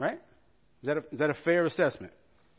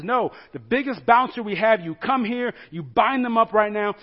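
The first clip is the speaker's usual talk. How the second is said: louder, higher barked order; angry king's command; 5 semitones higher, 6 dB louder than usual. Acted. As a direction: command your biggest men furiously. King commands the bouncers.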